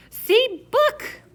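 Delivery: put-on high little-kid shout; a toddler who won't be ignored; small boy holds book over his head, shouting high. A narrator's reading. Toddler Fudge insisting louder.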